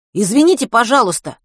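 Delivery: high, loud, urgent apology; urgent calling out. A narrator's reading. Matvey rushing up to two strangers, calling out urgently. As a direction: call out to get their attention. Матвей окликает женщину и водителя.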